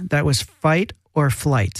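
"fight or flight" said slowly and distinctly; clarifying repeat. Shown as a demonstration. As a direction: spell out "fight or flight" word by word for anyone who missed it. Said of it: "if you couldn't understand what he said".

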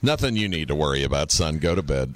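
mom brushing off. Retold or imitated. Mom brushing off her son's question, low and brisk. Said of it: "And she goes".

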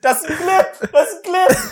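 high, frantic mock cry of clip; excited mock shout. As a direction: squeal it like an overexcited streamer. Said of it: "irgendwann go".

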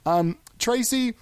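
usual speaking voice

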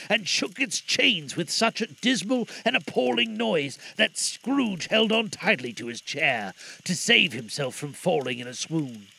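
ordinary delivery

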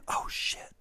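whispered reaction. Real, not acted. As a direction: breathe it out unvoiced.

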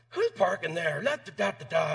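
grouchy driver. Retold or imitated. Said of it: "such a grouchy driver".

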